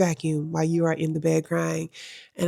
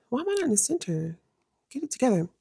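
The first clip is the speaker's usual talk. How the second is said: muttered to self; 5 semitones higher, 8 dB quieter, faster than usual. Real, not acted. Fixing camera framing.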